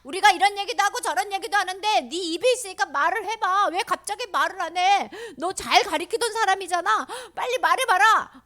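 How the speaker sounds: loud, high-pitched interrogation; demanding high priest. Acted. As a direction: press the silent prisoner for an answer. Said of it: "제사장이 말을 했어요".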